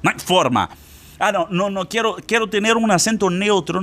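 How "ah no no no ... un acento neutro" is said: higher-pitched mock objection; protesting student. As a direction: play a student pleading to get out of it. (estudiante que protesta, en tono agudo)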